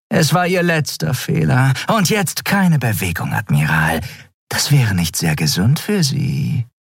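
high voice sinking toward the end; purring traitor Shiar. A narrator's reading. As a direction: taunt a captive with a silky threat.

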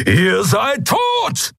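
slow, high-pitched roar; thundering shout. A narrator's reading. Keru threatens the attackers, thundering shout. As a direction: roar the threat, drawing out each word. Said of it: "donnerte Keru".

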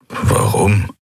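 puzzled, toneless question; barely voiced, baffled man; honest confusion. A narrator's reading. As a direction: ask it in real puzzlement. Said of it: "Ehrliche Verwirrung lag in seiner Stimme".

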